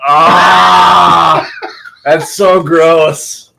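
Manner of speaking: slow, disgusted groan at the smell; smelling something awful. Real, not acted. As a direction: recoil from a foul whiff. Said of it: "It's like dirty earth".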